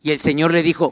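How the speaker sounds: ordinary talk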